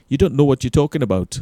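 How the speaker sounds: put-on quick, loud stab at a Geordie accent; bad Geordie accent. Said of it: "that's a shit accent".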